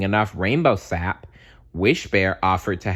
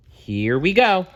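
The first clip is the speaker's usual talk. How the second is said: bright lift on the opener, louder; excited opener; 11 semitones higher, 4 dB louder than usual. Acted. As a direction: pump up the listener to start. Reading to child, excited opener.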